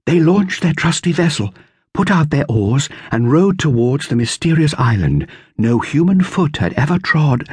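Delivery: put-on young storyteller, lifted and dramatic; Dorothea storytelling. A narrator's reading. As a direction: spin it like an adventure story. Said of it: "making up a story".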